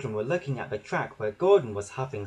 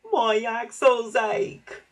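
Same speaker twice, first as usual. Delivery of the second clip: high, slow, drawn-out complaint; tired little engine moaning in pain; weary wheeze; 9 semitones higher, 4 dB louder, much slower than usual. A narrator's reading. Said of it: "poor Toby wheezed to James".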